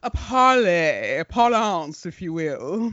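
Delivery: hammed-up British accent; put-on British accent. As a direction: affect a mannered British accent. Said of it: "supposed to be a British accent".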